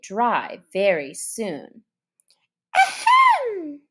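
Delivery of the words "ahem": loud, high self-important throat clearing; important ahem. Acted. Mouse calling for attention, pompous ahem. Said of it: "said the Mouse with an important air".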